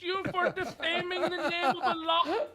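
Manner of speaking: high, loud, solemn decree; Ayatollah voice. Pretend. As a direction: proclaim it like a stern cleric.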